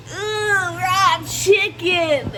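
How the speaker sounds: grossed out by the chicken; disgusted. Real, not acted. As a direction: drag it out grossed out. Handling raw chicken.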